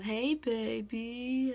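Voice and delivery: drawn-out mock flirty call; background catcall. Eddie calling from the background while she's on the phone, mock flirty. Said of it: "Eddie's in the background going".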